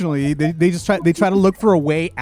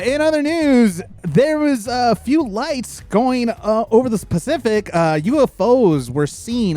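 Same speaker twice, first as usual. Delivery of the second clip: mock news anchor; 6 semitones higher, slower than usual. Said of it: "mock newscaster segue".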